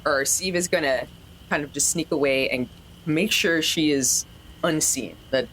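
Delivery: ordinary delivery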